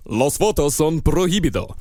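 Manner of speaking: Spanish line in a put-on voice; mock official Spanish warning; third voice in Spanish. A third alien voice forbidding photos in Spanish. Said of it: "third voice said".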